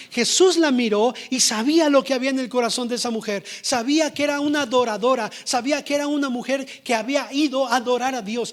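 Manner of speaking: fervent preaching. Acted. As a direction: preach it with full force. Preaching at full force. (predicación fervorosa y fuerte)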